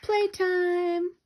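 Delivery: high, loud gleeful shout; screamed. A narrator's reading. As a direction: yell it like kids let loose. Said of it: "screamed the twins".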